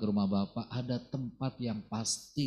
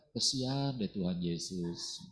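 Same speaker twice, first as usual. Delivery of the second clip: mock pity; 5 semitones lower, 4 dB quieter, slower than usual. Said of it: "nggak bilang-bilang".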